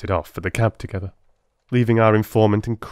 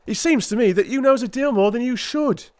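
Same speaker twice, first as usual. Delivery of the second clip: lightly voiced, higher suspicious policeman; suspicious constable; 3 dB louder than usual. A narrator's reading. Constable suspiciously challenges Holmes, a hint of cockney. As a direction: accuse him warily, a touch higher. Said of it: "he cried".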